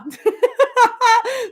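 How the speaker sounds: high, loud peal of laughter. Real, not acted. Vlogger relating to viewer's PMS comment, loud laugh. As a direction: break into a big high laugh.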